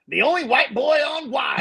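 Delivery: high, hyped-up station promo; radio sweeper. Retold or imitated. Imitating his hip hop station's sweeper, high hyped promo voice. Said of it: "I had sweepers that would go".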